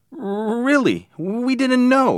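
very high, scared stammer; frightened pleading. A narrator's reading. Richie pleading with the angry man, frightened.

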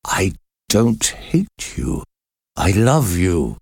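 slow, understated agony; agonized. A narrator's reading. Priest kneels and declares love to prisoner, mildly agonized. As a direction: draw the words out slowly with a touch of agony. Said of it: "in a voice full of agony".

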